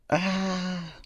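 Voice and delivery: high-pitched acted scream. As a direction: cry out high in mock terror. Acting out Auntie's scream in story.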